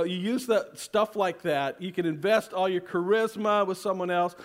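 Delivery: usual speaking voice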